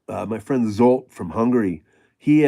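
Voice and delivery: usual speaking voice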